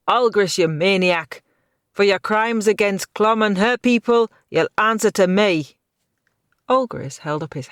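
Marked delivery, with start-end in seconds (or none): 0.1-5.8 furious challenge. A narrator's reading. Hero challenging the villain.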